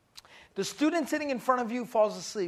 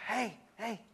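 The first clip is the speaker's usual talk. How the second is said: hushed hey, much softer; whisper; 11 dB quieter than usual. Retold or imitated. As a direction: call him quietly from behind. Classmate whispering.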